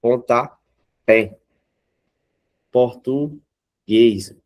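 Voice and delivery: "pontapé" and "português" broken before a strong last syllable; final-syllable stress. Shown as a demonstration. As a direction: slowly land on PÉ in "pontapé", GUÊS in "português". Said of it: "o fé mais forte".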